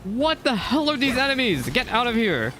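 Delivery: startled, high-pitched yelp at the enemies; alarmed outburst. Real, not acted. Gamer swarmed by enemies, startled high shout. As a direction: shriek at the sudden enemies.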